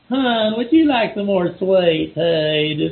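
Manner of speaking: slow, syrupy Southern drawl; a waitress in Georgia offering refills, in a slow Southern drawl. Retold or imitated. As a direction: sweet-talk like a Georgia diner waitress. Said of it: "the waitress in the restaurant".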